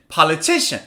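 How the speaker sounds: "Politician" in one go, TI stressed; quick "Politician" peaking on the third syllable. Shown as a demonstration. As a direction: lean on TI in a joined-up "Politician".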